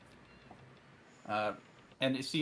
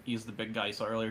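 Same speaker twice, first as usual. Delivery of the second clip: normal talking voice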